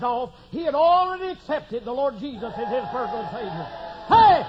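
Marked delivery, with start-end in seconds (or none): 4.1-4.4 loud, high preaching shout; fiery preaching. Real, not acted. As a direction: preach it out at full force. Preacher at full force.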